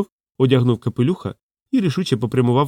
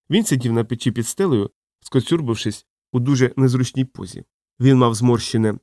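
ordinary delivery

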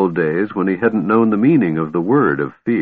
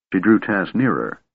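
ordinary delivery

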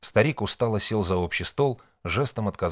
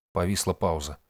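usual speaking voice

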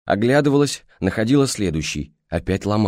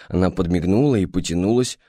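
plain reading voice

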